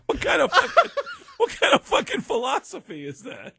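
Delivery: laughing through the words, pitch up high; cracking up in real disbelief. Real, not acted. Telling the host the punchline, laughing hard.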